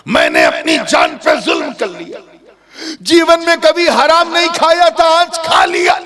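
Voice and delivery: high, loud, anguished; loud tearful confession; pleading confession. Acted. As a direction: beg the old man, voice rising. Youth begs orchard owner.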